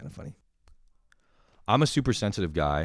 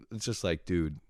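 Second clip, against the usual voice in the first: normal talking voice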